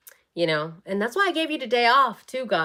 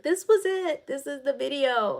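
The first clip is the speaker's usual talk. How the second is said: much higher, thrilled proclamation; excited announcement; 7 semitones higher than usual. Retold or imitated. Hyping her own recipe video to viewers, much higher and thrilled. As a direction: announce it like a big reveal. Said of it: "I'll be like".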